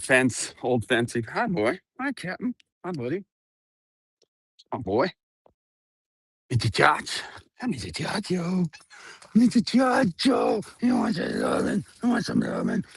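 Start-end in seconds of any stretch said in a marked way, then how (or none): 1.2-10.6 dog talk. Real, not acted. Greeting dogs in pet voice. 10.8-11.8 much lower goofy growl of a question to the dog; deep silly voice. Real, not acted. Vlogger teasing her dog, deep goofy voice. 12.0-12.8 high whisper. Real, not acted. Vlogger cooing to her dog, high whisper. Talking to dogs, silly voice.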